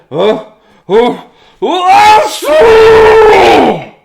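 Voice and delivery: rising oh-oh-oh into a huge shouted achoo; big sneeze. Acted. Reading to kids, Andrew's giant sneeze. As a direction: build up and explode the sneeze.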